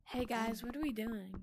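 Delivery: soft put-on girl voice; lightly faked girly greeting; man doing a woman's voice. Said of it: "I gotta get my girl voice ready".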